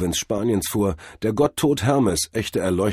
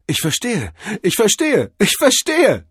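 each repeat higher and louder; mounting realisation; 7 dB louder than usual. A narrator's reading. Cordell finally grasps it, excitement climbing. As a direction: build from dawning insight to a high cry.

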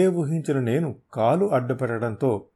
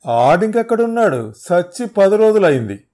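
much higher, loud outburst; loud exclamation; 8 semitones higher, 11 dB louder than usual. Acted. Thug telling the hero the man is dead, loud outburst. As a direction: blurt out the death.

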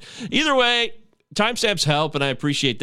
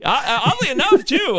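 laughing; 11 semitones higher, 4 dB louder than usual. Real, not acted. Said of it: "laughing after the joke".

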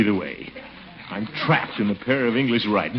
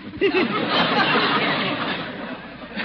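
high delighted giggle of vanity; laugh, tickled with himself; pleased laugh. Acted. Delighted with his new outfit.

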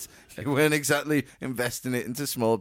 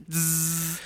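buzzing noise; 5 semitones higher than usual. Retold or imitated. Imitating pressing the button.

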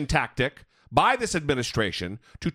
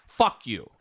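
ordinary delivery

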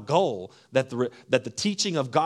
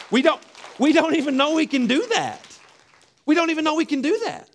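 much higher and louder, pleading; exasperated preaching; 10 semitones higher, 4 dB louder, faster than usual. Real, not acted. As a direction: cry it out in disbelief to the congregation. Preacher exclaiming in frustration.

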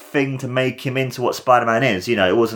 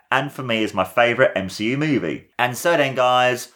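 ordinary talk, nothing marked

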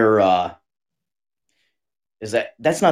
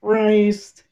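very slow, stretched exclamation; drawn out; 11 semitones higher, 4 dB louder, much slower than usual. Real, not acted. Friend reacts to the crude movie line, slow stretched. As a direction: drag out Jesus Christ, higher.

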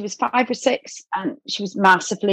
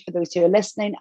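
ordinary talk, nothing marked

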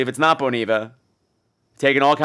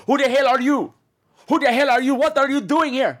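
aggressive stranger; 11 semitones higher than usual. Retold or imitated. Imitating the man who grabbed her, high mock yell. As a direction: bark it like a threatening stranger. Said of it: "he's like".

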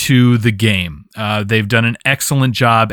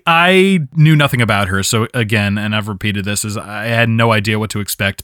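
usual speaking voice

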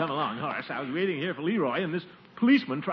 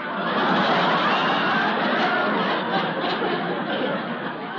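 nervous laugh; 12 semitones higher, 3 dB louder than usual. Acted. Caught selling papers, embarrassed.

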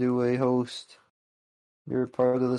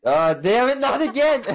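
frustrated outburst; 12 semitones higher, 6 dB louder than usual. Real, not acted. Flubs his own name.

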